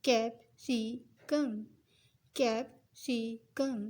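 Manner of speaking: कैप्सिकम said very slowly, syllable by syllable; slow three parts. Shown as a demonstration. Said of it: "इसको three parts में पढ़ें". (कैप्सिकम को तीन हिस्सों में धीरे पढ़ना)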